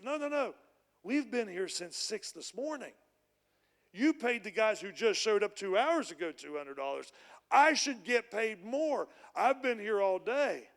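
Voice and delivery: loud, slow, aggrieved protest; indignant complaint. Acted. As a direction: whine that it isn't fair, loud and drawn out. Grumbling workers protest pay.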